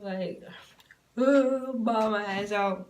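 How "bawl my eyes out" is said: rueful laugh. Real, not acted. Retelling breaking down at KFC.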